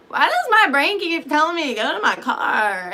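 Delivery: high, loud bewildered question; baffled. Retold or imitated. Asking herself about the car, high and baffled.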